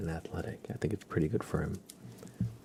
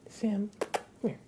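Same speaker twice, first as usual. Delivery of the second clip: high, slow pet call; calling the dog. Real, not acted. Streamer calls her dog over, high sweet pet voice.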